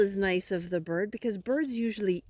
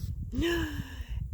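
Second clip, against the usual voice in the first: soft gasp; 6 semitones higher, 5 dB quieter than usual. Acted. Story surprise gasp.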